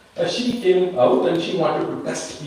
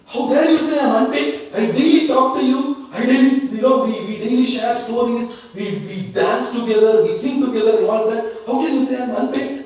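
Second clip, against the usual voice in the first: much higher and louder, outraged protest; indignant outburst; 9 semitones higher, 3 dB louder than usual. Retold or imitated. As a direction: fume like an insulted devotee. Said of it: "Namdev got very angry".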